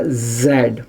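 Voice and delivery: "जेड" drawn out slowly with a buzzing z; slow demonstration of "जेड" with initial z; correct English Zed. Shown as a demonstration. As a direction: stretch "जेड" from a z, not a j. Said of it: "ऐसे बोलना पड़ेगा इसको".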